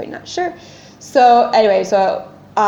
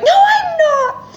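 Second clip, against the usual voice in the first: very high, drawn-out wail; acting out hysterical contradiction; screaming; slower than usual. Patient rejecting reassurance from a bathroom stall, screaming. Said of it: "screaming in this".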